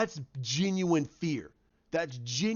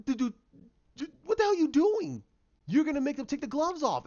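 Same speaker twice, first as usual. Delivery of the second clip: exasperated yell; 9 semitones higher, faster than usual. Real, not acted. Fan yelling at the screen, exasperated shout. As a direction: shout at the show in disbelief.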